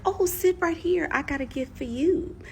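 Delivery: calm, sweet bait; calmly. Retold or imitated. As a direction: lure him in gently. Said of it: "So I calmly said".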